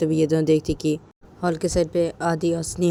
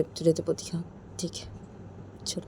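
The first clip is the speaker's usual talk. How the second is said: coaching a friend, said much softer; softly; 11 dB quieter than usual. Shown as a demonstration. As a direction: drop to a soft murmur on तुझे तो बोलती हाँ. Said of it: "तुझे ऐसे बोलती है".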